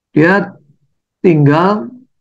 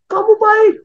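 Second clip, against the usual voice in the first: distant echo. Acted. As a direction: sound like the valley answering. Echo answers back. (gema menjawab dari kejauhan)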